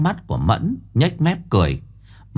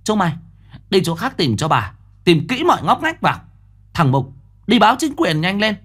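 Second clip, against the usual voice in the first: barking orders; 10 semitones higher than usual. Acted. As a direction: drive the servants to search faster. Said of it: "Bà quay lại nói với đám kẻ ăn người ở".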